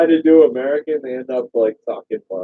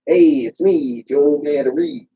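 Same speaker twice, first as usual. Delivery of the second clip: lightly voiced comedian catchphrase; a hint of Joe Matarese's greeting; Joe Matarese impression; slower than usual. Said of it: "Did I just hear Joe Matarese?".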